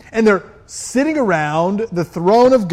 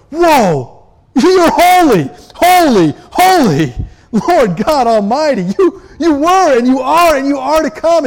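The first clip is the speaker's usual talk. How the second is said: much higher and louder, breathless wonder; awestruck praise; 7 semitones higher, 6 dB louder than usual. Acted. Creatures before the throne, loud high overwhelmed praise. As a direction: cry out as if dazzled by God. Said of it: "they go".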